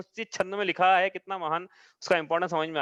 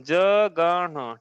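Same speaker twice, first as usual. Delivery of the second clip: जगण stretched very slowly, syllable by syllable; जगण said long and slow, twice; drawn out; much slower than usual. Shown as a demonstration. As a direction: dictate जगण slowly for notes. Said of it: "दो बार जगण लिखना है".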